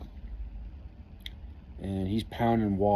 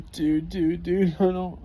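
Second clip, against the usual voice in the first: higher, flustered stammer of a panicked buddy; flustered friend; 7 semitones higher, slower than usual. Retold or imitated. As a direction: stammer like you have no clue, pitched up. Friend can't explain phone.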